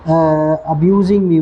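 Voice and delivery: very slow teacher model of abusing me; slow model reading. Shown as a demonstration. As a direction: draw out abusing me for the class. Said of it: "teacher models phrase slowly".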